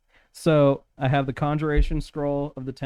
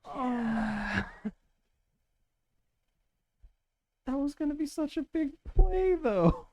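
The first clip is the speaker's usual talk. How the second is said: disappointed whine; 13 semitones higher, slower than usual. Real, not acted. Big plan just fizzled.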